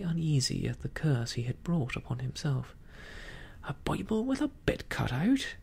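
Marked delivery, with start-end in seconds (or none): 3.6-5.6 high, incredulous pirate scoff; derisive. A narrator's reading. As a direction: scoff at the foolish idea, pitch leaping. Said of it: "returned Silver derisively".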